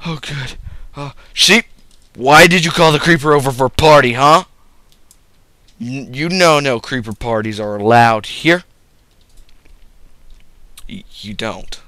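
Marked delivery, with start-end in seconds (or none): 1.0-8.6 loud, fast mock telling-off; mock scolding. Player scolds his sheep for inviting creeper, loud mock anger. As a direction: scold the sheep like a naughty pet.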